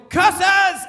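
high, loud cartoon-villain yell; mock yell. Dad hits his shin and yells at the moon, hammed-up yell. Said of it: "raised his fists and just yelled".

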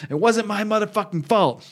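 high-pitched indignant protest; acted angry denial. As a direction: deny it hotly, acting out a fight. Acted fight after a punch.

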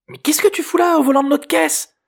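put-on very high, loud girl's outburst; shrieked accusing question; outraged teen girl. A narrator's reading. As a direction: confront the intruder as an alarmed, indignant teenager. Girl confronts stranger in stolen car.

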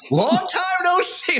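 high, slow, smug hello; mock-smug high drawn-out greeting; Tiny's greeting. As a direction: savour the reunion like a cat. Tiny meeting him years later.

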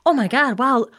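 high, gushing and amazed; gushing over the cat. Retold or imitated. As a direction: coo over the pretty kitty. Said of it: "be like".

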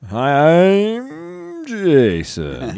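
high, very slow intro line; slow drawn-out intro. Pretend. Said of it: "why we need to mix it up".

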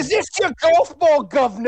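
high, loud mock British accent; hammed-up British put-on. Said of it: "and he said".

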